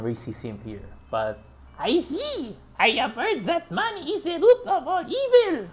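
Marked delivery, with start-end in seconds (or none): 1.8-5.7 put-on high laughing German-accented line; mock German accent through laughter; hammed-up high German accent, laughing. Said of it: "German Nazi accent".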